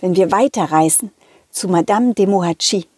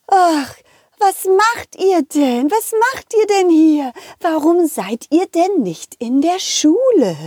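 higher, slow huffy schoolteacher voice; a lemon teacher demanding answers; lightly voiced stern teacher; indignant teacher; 8 semitones higher than usual. Lemon teacher scolding children.

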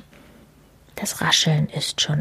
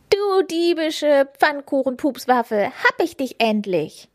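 higher, gleeful creature voice; triumphant creature; 7 semitones higher than usual. A narrator's reading. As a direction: crow over the caught thief. Schnüffelo triumphant.

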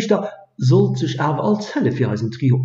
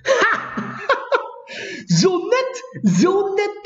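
high laughing warlock; 12 semitones higher, much slower than usual. Acted. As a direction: burst into mocking laughter. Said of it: "fängt nun hart mit Lachen".

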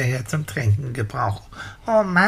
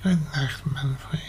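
nodding off; 4 dB quieter, slower than usual. Acted. Falling asleep on blanket.

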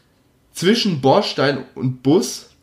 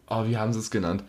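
ordinary talk, nothing marked

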